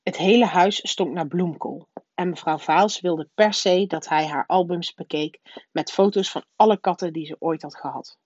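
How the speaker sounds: plain reading voice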